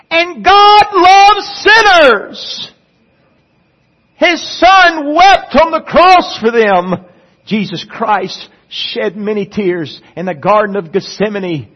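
high, loud, slow preacher's cry; full-force preaching. Acted. Pastor pleads with the church about sinners, full-force preaching. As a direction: thunder it from the pulpit, stretching each phrase.

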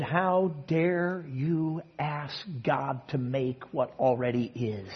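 very slow, soft, solemn rebuke; voice of the Spirit. Retold or imitated. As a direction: let the Spirit's words fall slowly and gently. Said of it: "the Spirit spoke to him and said".

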